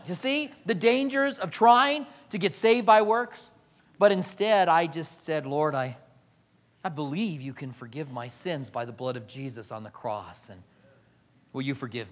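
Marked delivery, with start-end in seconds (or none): 5.5-12.1 low, soft humble plea; humble prayer. Retold or imitated. Preacher acts a sinner quietly asking God's forgiveness.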